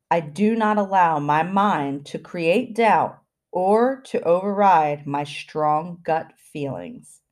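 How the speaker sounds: slow, deliberate delivery with long pauses; slow affirmation. Shown as a demonstration. Podcast host giving listeners affirmations to repeat, slow and deliberate. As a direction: say it slowly, phrase by phrase. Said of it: "I'm gonna say it slow".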